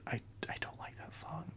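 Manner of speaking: hushed admission to the listeners; confessional whisper. Real, not acted. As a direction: drop to a guilty whisper as if sharing a secret. Confessing an unpopular opinion.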